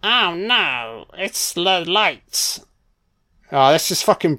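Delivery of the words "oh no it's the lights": drawn-out, wavering fake wail; mock dread. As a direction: stretch it into a slow mock cry of horror. Voices victims seeing the light.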